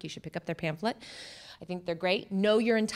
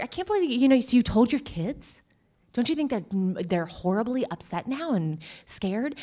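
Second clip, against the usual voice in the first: incredulous acquaintance; 2 semitones higher, 6 dB louder than usual. Retold or imitated. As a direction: mimic a nosy friend's shock. Voicing a judgmental acquaintance.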